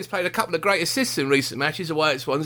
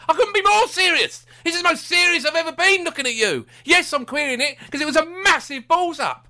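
furious rant; 8 semitones higher than usual. Real, not acted. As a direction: rail at the manager, all worked up. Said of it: "Now I'm furious".

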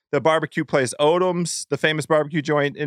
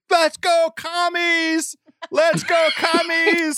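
put-on high-pitched excited kid's chant; impersonating a cheering child, pitched way up; imitated yelling; 13 semitones higher, much slower than usual. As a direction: yell it like a son tearing around the house. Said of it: "the stairs yelling".

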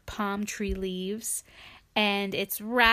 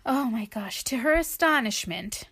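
ordinary delivery, nothing marked

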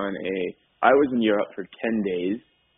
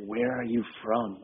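hushed, slow retelling of a whisper; imitated whisper; 6 dB quieter than usual. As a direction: lean in and ask it softly and slowly. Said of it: "whispers in my ear".

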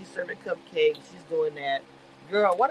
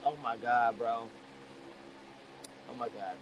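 stunned hush; 9 semitones lower, 11 dB quieter than usual. Retold or imitated. Her own retold shock.

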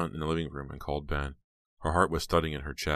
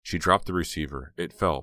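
usual speaking voice

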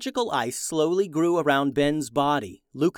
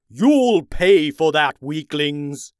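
loud, slow villain shout; 9 dB louder, slower than usual. Acted. Ice King attacking the mocking brothers, loud slow furious shout. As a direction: roar the threat before striking. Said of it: "the Ice King shouted".

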